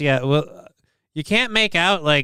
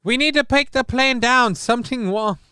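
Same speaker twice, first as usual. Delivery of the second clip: high, hammed-up alarm; jokey fake emergency call; mock panic; 10 semitones higher than usual. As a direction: play a panicking pilot for laughs.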